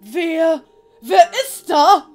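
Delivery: high, loud, startled question; alarmed calling. Acted. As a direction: call out anxiously into the cell. Startled prisoner calls out.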